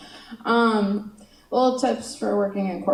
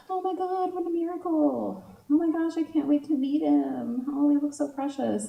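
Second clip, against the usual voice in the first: high-pitched mock gushing, kept fairly soft; gushing commenters; 6 semitones higher, 3 dB quieter than usual. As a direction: coo over a baby photo, mockingly. Gushing commenters on baby photos.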